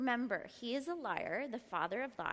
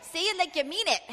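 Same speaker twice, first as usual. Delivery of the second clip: louder, higher push to the room; urging crowd; 6 semitones higher, 10 dB louder than usual. Real, not acted. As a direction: fire them up. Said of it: "urging the crowd".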